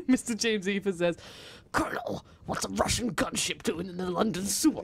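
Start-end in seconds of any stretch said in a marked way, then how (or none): 1.7-4.8 breathy hissing snake voice; snake impression. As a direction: hiss it out like a snake. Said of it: "Is that your, uh, snake impression?".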